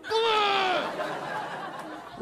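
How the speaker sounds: high, hammed-up exasperated grunt; a playful mock-tough groan; mock butch grunt. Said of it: "we'd both be like".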